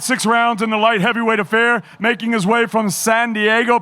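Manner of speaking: usual speaking voice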